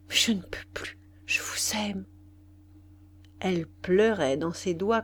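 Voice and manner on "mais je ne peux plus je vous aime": breathless whispered surrender; soft, voiceless confession; panting voice. A narrator's reading. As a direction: let the voice give out into a whisper. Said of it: "Elle reprit d'une voix haletante".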